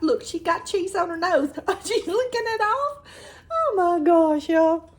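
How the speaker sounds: high, loud and laughing with delight; delighted squeal. Real, not acted. Daughter with cheese on nose.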